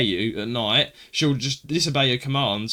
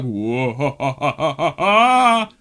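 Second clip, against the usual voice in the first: mock creepy laugh; 5 dB louder, much slower than usual. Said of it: "and it's like".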